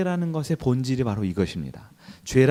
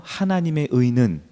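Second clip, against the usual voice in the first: 하나님의 의는 said slowly with long pauses between phrases; slow repeat-after-me. Shown as a demonstration. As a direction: spell it out phrase by phrase for the congregation to echo. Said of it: "한번 따라해 볼까요?".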